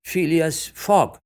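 loud, high, drawn-out name call; ringing clerk's summons; court roll call. A narrator's reading. Clerk calls out names.

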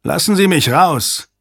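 high, urgent demand; shouted demand. A narrator's reading. Hale demanding release from the commander, high and urgent. Hostage-taker demands to be let out.

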